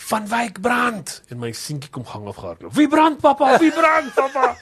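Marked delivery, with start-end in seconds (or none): 0.1-1.2 drawn-out high call across the house; shout. Retold or imitated. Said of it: "ek skree". 2.7-4.5 put-on high, loud little boy asking; child voice. Father voices his little son.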